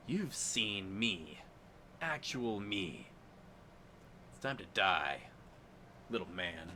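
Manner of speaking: low, slow, soft menacing whisper; menacing drow. Acted. Drow to the gnome, slow soft threat. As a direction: lean in and threaten him quietly, slowly.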